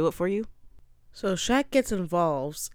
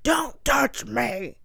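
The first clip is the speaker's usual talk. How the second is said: slow, high mock yell; furious retort, hammed-up and drawn out; angry outburst; 7 semitones higher, 3 dB louder, much slower than usual. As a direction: draw out each word in an angry imitation. Acted quarrel, he snaps at her.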